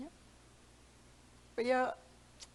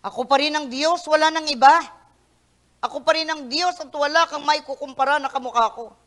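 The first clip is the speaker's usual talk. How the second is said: louder, higher voice of God; God's declaration; 5 semitones higher, 9 dB louder than usual. Acted. Preacher voicing God's claim in Tagalog. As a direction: proclaim it as God himself.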